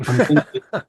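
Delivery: laughing through the words; breaking into a laugh mid-phrase; half-laughing at his own story. Real, not acted. Laughing while retelling embarrassing moment.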